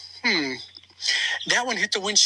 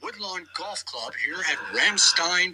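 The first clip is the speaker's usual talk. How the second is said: announcer sign-off; 4 semitones lower than usual. Pretend. Closing broadcast sign-off.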